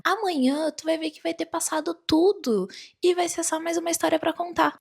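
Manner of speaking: lightly voiced grandma soothing her granddaughter; consoling grandma. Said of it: "minha avó falou bem assim".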